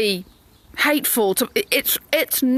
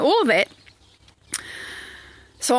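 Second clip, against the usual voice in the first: ordinary talk, nothing marked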